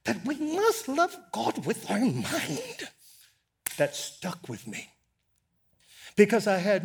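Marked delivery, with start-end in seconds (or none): 0.0-2.9 high squeaky voice, slowed down; James Cone's squeaky voice. Retold or imitated. Preacher keeps imitating the theologian's emphatic squeaky line. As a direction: squeak it out thin and insistent. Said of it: "he was emphatic about that".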